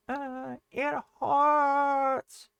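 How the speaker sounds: put-on high, very slow whiny baby talk; mock whining complaint. Shown as a demonstration. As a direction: drag it out in a high little whine. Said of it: "can come across as being a little bit whiny".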